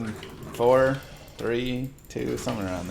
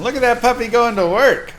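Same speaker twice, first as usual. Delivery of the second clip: excited; 11 semitones higher, 8 dB louder than usual. Real, not acted. Watching a big attack land.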